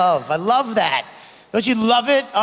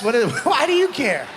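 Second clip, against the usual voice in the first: ordinary delivery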